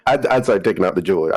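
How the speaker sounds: usual speaking voice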